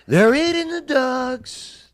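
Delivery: high, slow, drawn-out parody; mock alarmed quote. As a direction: stretch it out high like a mocked politician.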